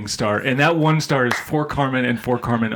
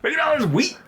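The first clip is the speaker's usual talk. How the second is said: high-pitched swoop on the quoted line; jarring, charisma-heavy delivery; Carmen impression; 11 semitones higher than usual. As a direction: stretch man, then shoot up high. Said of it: "very Carmen delivery with all his charisma".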